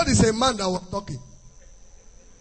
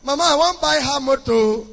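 put-on mommy's boy asking mama; 4 semitones higher than usual. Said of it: "let me find out from mama".

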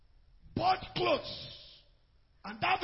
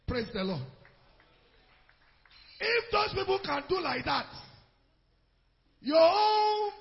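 rising to a shouted sermon climax; fiery preacher; preaching at full force; 7 semitones higher, 9 dB louder than usual. Real, not acted. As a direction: preach it loud to the church.

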